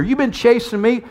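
high-pitched, pleading complaint; acted grievance. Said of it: "he's talking about what he's been through here".

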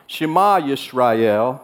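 "Shema Yisrael" said slowly in Hebrew, stress on the second syllable of "Shema"; slow, solemn Hebrew "Shema Yisrael"; slowly chanted Hebrew "Shema Yisrael"; Hebrew pronunciation. Shown as a demonstration. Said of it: "It's called the Shema".

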